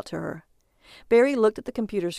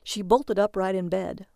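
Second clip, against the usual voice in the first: ordinary delivery, nothing marked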